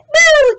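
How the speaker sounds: high, drawn-out whoop; piercing imitated attack noise; loud whoop. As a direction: whoop it out at full volume.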